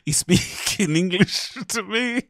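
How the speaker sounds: laughing while speaking. Real, not acted. Host amused at accent debate, laughing high.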